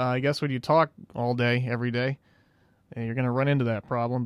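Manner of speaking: ordinary delivery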